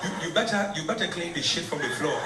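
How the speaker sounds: lightly put-on African accent; hammed-up African accent; terrible African accent. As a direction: play a husband with a bad African accent. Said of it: "This accent is terrible".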